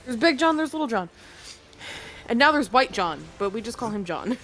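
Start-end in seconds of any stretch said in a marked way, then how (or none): none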